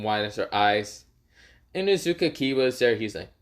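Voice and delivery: ordinary delivery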